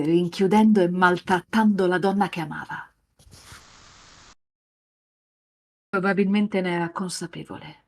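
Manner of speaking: racconto lento e cupo da narratore; narrazione del master. A narrator's reading. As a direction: leggi la scena come un master che racconta. (narrazione grave e raccolta)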